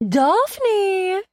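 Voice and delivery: calling a name out high and loud; excited shout. A narrator's reading. Kristen greeting her friend from the limo, a gleeful loud shout. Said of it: "even louder than Kristen had".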